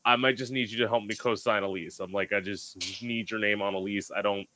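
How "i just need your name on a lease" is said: ordinary talk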